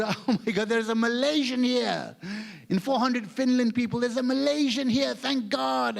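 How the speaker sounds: high, hammed-up amazement; excited relief. Comedian finding one Malaysian among four hundred Finns, high excited relief. Retold joy at finding a countryman.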